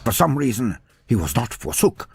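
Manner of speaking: ordinary delivery, nothing marked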